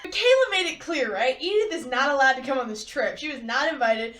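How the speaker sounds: usual speaking voice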